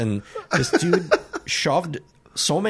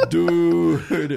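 stunned dude; 5 semitones higher than usual. Retold or imitated. Clerk reacting to the soaked customer, stunned. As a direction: let the awe out in one word. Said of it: "I was like".

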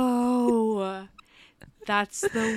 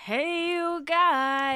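higher, very slow, drawn-out hello; imitating signature greeting; 8 semitones higher, much slower than usual. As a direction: copy her trademark hello, much slower. You have to do that every time.

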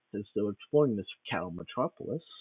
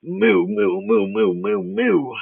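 loud high cow moos; loud mooing cow; 11 semitones higher, 13 dB louder, much slower than usual. Acted. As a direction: bellow like a cow. Loud cow mooing opens the scene.

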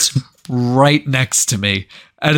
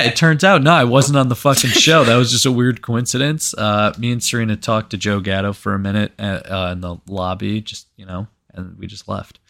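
usual speaking voice

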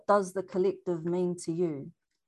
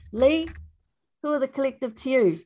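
calling out; 7 semitones higher, 5 dB louder than usual. Real, not acted. Host calling on next speaker.

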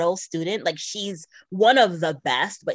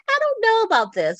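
doubtful quote; 4 dB louder, faster than usual. Retold or imitated. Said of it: "So I was like".

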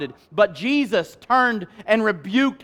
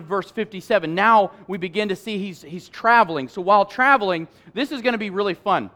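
ordinary talk

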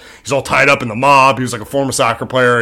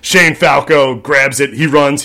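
normal talking voice

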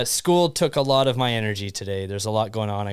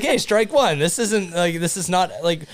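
exclaiming; 6 semitones higher, 3 dB louder than usual. Retold or imitated. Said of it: "I was like".

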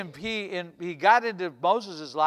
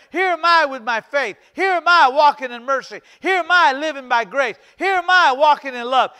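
raised, loud preaching refrain; rousing declaration; 4 semitones higher, 7 dB louder than usual. Acted. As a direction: hammer each pledge out to rally the church. Acts out congregation volunteering to God.

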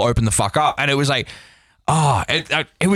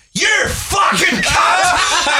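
imitating an enraged stranger's scream; retold furious yell; much slower than usual. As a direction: yell it like the drunk woman in the pit. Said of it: "and she went".

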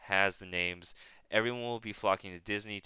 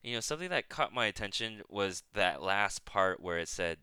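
ordinary talk, nothing marked

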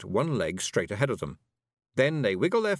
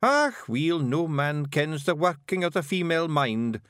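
lofty; 6 semitones higher, 3 dB louder than usual. Acted. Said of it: "said Rob Anybody loftily".

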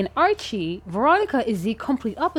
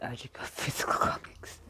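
whispering; 8 semitones lower, 11 dB quieter than usual. Real, not acted. Whispered mocking echo.

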